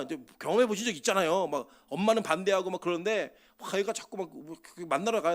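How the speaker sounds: ordinary delivery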